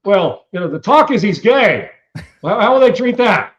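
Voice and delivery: high, loud incredulous TV host voice; mock Kilmeade asking it straight-faced; imitating Kilmeade. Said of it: "Kilmeade looks at me and goes".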